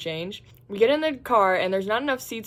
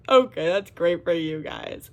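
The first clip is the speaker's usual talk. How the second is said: sarcastic congratulations; 2 semitones lower, 5 dB louder, slower than usual. Retold or imitated. Forced polite reply.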